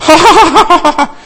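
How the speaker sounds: loud, high-pitched ha-ha laugh; put-on peal of laughter; acted laughter. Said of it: "als sie anfing zu lachen".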